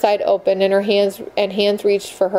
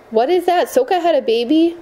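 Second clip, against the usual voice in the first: higher, stunned disbelief; shocked question; 8 semitones higher than usual. A narrator's reading. Moni shocked by the news.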